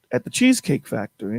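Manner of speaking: ordinary delivery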